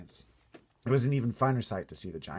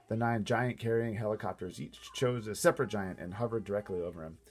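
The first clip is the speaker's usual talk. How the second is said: ordinary delivery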